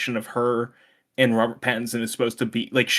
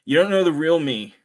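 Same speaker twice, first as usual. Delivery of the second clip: usual speaking voice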